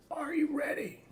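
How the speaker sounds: very high squeaky put-on voice; high-pitched, goofy echo of the question; silly falsetto. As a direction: leap into a playful falsetto.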